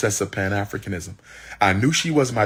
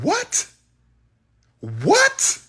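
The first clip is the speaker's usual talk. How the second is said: shocked high squeak. Retold or imitated. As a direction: voice jumps way up in disbelief. Shocked at her news.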